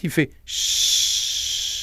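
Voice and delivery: long unvoiced ch hiss, pushed from the belly; breath hiss. Shown as a demonstration. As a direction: let the air out on a slow ch. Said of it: "il pousse sur le ventre".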